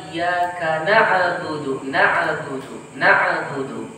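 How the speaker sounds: na'budu with a bounced, echoing ain; qalqalah on ain. Shown as a demonstration. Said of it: "membacanya dengan qalqalah". (ain na'budu dibaca qalqalah)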